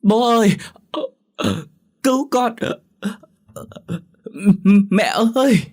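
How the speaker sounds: put-on high, loud drowning girl's scream; desperate high-pitched cry for rescue; screaming for help. A narrator's reading. Drowning daughter calls to her parents, screaming. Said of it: "hét to".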